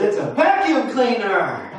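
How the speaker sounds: a hammed-up proud husband, laughing. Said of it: "And he said".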